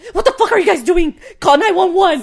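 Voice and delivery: high, loud panicked shout; panicked yell. Retold or imitated. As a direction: yell for help as if trapped. Brother panicking in stuck elevator.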